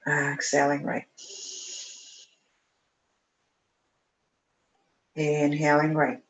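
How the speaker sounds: calm, even breathing instructor; guided breathing cues. Acted. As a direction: count the breath steadily and calmly. Leads alternate nostril breathing.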